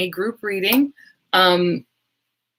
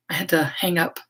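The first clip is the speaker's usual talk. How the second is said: a real, voiceless whisper, voice nearly gone; whispery cat voice; 3 semitones lower, 5 dB quieter than usual. Real, not acted. Streamer whispers to her viewers with a failing voice. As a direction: push the words out on breath alone. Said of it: "Oh, I sound like a cat".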